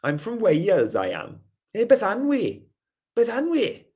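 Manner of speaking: lilting, high Valleys Welsh; Valleys Welsh accent. Shown as a demonstration. Streamer showing how they talk in the Valleys, Valleys Welsh accent. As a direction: swing the pitch up like broad Valleys Welsh. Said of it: "they talk like".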